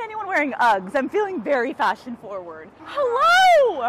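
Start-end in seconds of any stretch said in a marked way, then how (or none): 2.8-3.8 greeting a fan. Real, not acted.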